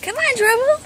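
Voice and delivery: mocking dog talk. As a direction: tease the dog in a high voice. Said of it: "Yes, I'm mocking you".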